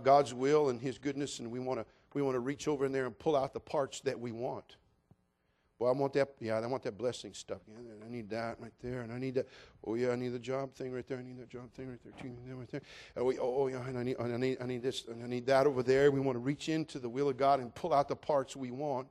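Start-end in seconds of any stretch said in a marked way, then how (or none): 5.8-16.1 lower, soft self-absorbed murmur; mock greedy rummaging. Believer picking parts of God's will, mock greedy murmur. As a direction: talk to yourself while picking out what you want.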